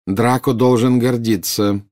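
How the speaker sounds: usual speaking voice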